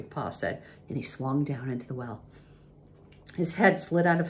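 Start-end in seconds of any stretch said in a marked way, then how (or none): none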